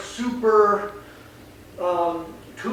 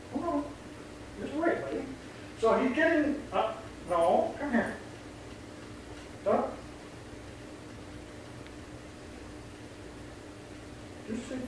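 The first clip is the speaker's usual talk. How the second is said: softer, higher coaxing to the dog; 6 semitones higher, 11 dB quieter than usual. Real, not acted. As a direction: gently settle the nervous dog. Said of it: "talking to the dog now".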